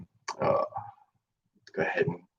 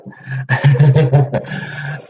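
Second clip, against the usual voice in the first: bright burst of giggles, higher and louder; real amused laugh, pitched up; 8 semitones higher, 5 dB louder than usual. Real, not acted. Amused after massaging one foot.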